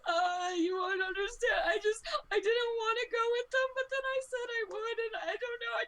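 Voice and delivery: put-on high, far-off sobbing girl voice, laughing through tears; overwhelmed rescued girl, crying and laughing at once. Ronnie weeping to rescuer.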